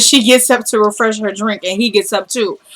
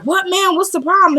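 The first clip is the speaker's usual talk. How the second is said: high-pitched retold complaint; indignant protest; 10 semitones higher than usual. Driver questions police who pulled him over, high indignant protest. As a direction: protest high, without raising volume.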